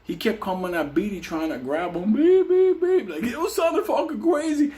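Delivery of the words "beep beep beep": high monotone "beep" on a steady pulse; alarm clock beeping. Retold or imitated. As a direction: mimic an alarm clock, one even pitch. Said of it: "like a fucking alarm clock".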